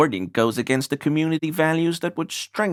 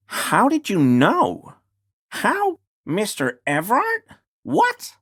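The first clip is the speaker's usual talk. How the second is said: shocked and climbing higher and higher; rising disbelief; 7 semitones higher than usual. Acted. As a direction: caught completely off guard, pitch spiking on each word. Character learns of break-in.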